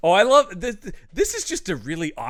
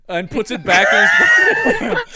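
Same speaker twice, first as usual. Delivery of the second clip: laughing; 8 dB louder, slower than usual. Real, not acted. Laughing while telling.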